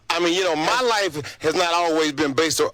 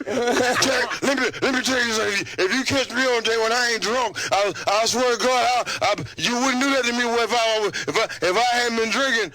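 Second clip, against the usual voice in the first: put-on slurred, higher drunken wrestling promo; drunk wrestler promo; 5 semitones higher than usual. New Jack imitating a drunk Knobs cutting a promo. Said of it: "do your best interpretation of the promo".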